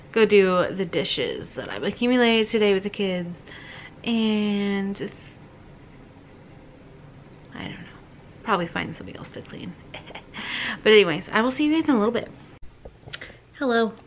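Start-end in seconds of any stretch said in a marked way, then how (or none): none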